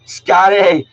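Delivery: loud, happy call of a student's name. Real, not acted. Teacher loudly greets a student logging on, calling out. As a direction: hail the student who just joined.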